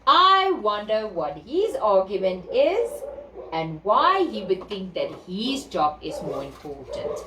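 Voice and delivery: ordinary delivery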